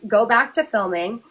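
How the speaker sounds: ordinary talk, nothing marked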